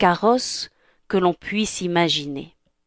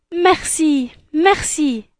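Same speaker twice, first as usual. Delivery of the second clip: Cinderella voice; 8 semitones higher, slower than usual. Acted. Cinderella grateful.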